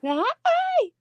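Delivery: high, sing-song greeting to a doll; high cooing hi. Real, not acted. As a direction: coo a high hello.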